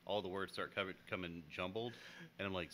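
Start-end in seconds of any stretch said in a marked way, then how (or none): none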